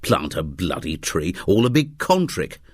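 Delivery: ordinary delivery, nothing marked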